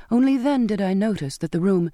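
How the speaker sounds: ordinary delivery, nothing marked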